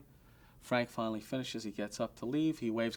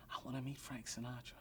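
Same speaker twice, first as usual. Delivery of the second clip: much softer, timid little ask; meek like a little lamb; 13 dB quieter than usual. Retold or imitated. Humbled manager begging the owner, soft and meek. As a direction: shrink your voice down like a lamb. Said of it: "like a little lamb".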